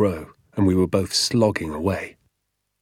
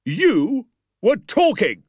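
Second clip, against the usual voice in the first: slow, high, furious bark; barked accusation; 14 semitones higher, 4 dB louder, much slower than usual. A narrator's reading. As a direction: bark it out word by word. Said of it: "he barked".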